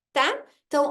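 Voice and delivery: ordinary talk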